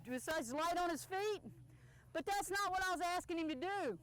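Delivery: normal talking voice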